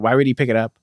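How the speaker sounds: normal talking voice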